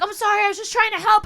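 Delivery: frantic apology. Retold or imitated. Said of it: "I'm like".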